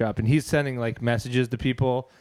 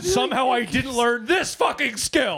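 high, hammed-up anguished outburst; Liam Neeson; 9 semitones higher than usual. Taken father lacking one skill, mock anguish. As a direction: wail at your own missing skill. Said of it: "it's like Liam Neeson in Taken".

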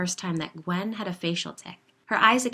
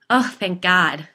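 relieved; 2 semitones higher, 11 dB louder, slower than usual. A narrator's reading. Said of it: "laughing along with her and feeling truly relieved".

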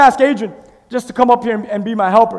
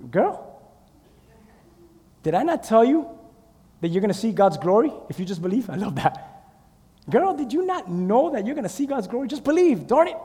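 sassy; 5 semitones lower, 10 dB quieter than usual. Acted. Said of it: "And Jesus like".

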